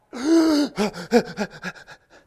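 sharp loud gasp of fright; panicked gasp. Pretend. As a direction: gasp like you're freaking out. Acting freaked out.

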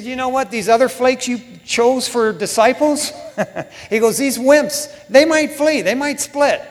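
acted-out brash disciple; dismissive boasting. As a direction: put down the others with loud, cocky certainty. Acting Peter's boast to Jesus.

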